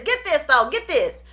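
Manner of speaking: usual speaking voice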